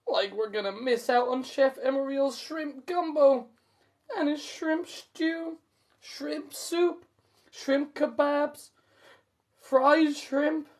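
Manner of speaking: high, slow dreamy Shaggy list; Shaggy reciting. A narrator's reading. Shaggy listing dishes to Scooby, high and slow. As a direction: savour each shrimp dish. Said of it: "Shaggy recites".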